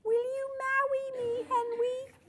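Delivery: very high, soft lisping plea; lisping little girl. A narrator's reading. Lily proposing to Henry, high lisping voice. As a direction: pipe it sweetly in a tiny voice. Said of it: "lisped Lily".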